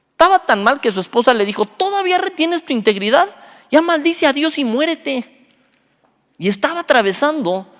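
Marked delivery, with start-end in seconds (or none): 1.6-5.5 high-pitched, scolding wife; Job's exasperated wife. Acted. Wife berating suffering Job for keeping his integrity, high-pitched scolding. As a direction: nag him in a high voice, slowing on the curse.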